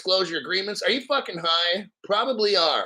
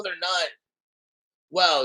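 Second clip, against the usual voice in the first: ordinary talk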